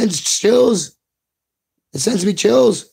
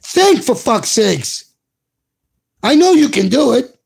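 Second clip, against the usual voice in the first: exasperated plea; 5 semitones higher than usual. Real, not acted. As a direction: scold them, then push them to do better. Frustrated plea to hosts.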